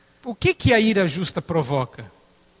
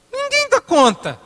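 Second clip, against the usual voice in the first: pitched way up in mock despair; exasperated high squeak; 4 dB louder than usual. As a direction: squeal it like nobody could ever manage. Exasperated punchline.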